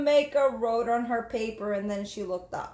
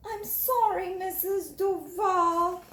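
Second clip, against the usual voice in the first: put-on timid little girl; 7 semitones higher, slower than usual. Jamaica answering her teacher, timid. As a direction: answer shyly.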